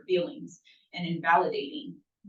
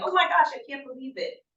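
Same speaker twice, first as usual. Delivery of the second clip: quick, high, put-on astonishment; feigned amazement; 7 semitones higher, faster than usual. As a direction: play along as if you understand the gossip. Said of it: "I just pretend like I know what she's saying".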